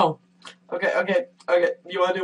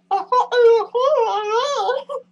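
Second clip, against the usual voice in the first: pained cries; much slower than usual. Real, not acted. Reacting to the sour candy.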